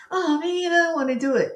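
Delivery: put-on whining child. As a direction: whine like a reluctant child. Voices child refusing homework.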